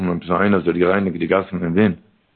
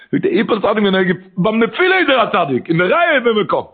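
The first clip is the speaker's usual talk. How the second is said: high, raised sing-song exclamation; fervent preaching; 12 semitones higher than usual. Acted. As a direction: lift the teaching into an urgent chant. Impassioned chanted preaching.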